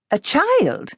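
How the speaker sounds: high, surprised question. A narrator's reading. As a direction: ask in disbelief. Woman surprised.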